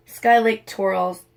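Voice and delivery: ordinary delivery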